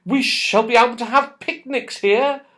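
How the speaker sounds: high and bright with excitement; glowing over the picnic plans; delighted. A narrator's reading. Beth to the others about picnics, delighted and high. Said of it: "said Beth in delight".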